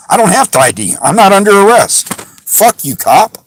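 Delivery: angry outburst. Real, not acted. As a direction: snap back at the cop, fed up.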